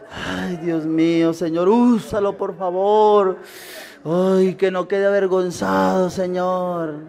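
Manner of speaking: slow, drawn-out mock plea to God; mock pleading prayer. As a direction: drag out a reluctant, doubting prayer. Voces de hermanos sin fe rogando. (súplica fingida, lenta y alargada)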